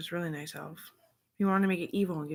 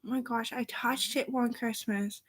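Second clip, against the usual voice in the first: put-on higher child voice, slower at the end; excited child; 7 semitones higher than usual. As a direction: play the niece telling mom breathlessly. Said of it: "And she's like".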